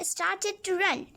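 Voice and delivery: plain reading voice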